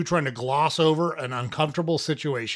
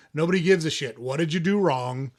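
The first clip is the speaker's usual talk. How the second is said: ordinary talk, nothing marked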